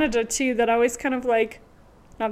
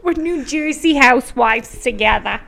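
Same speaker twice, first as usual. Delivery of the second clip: loud, hammed-up New Jersey accent; New Jersey housewife; 6 dB louder than usual. Bonding with co-host, New Jersey housewife accent. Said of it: "We do a good job with the accents".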